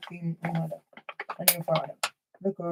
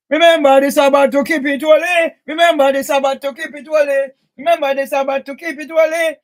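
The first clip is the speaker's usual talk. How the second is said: loud, preaching repetition of the commandment; shouted commandment; 2 semitones higher, 8 dB louder than usual. Real, not acted. Ranting at the neighbours, shouted commandment. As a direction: bellow it like a street preacher.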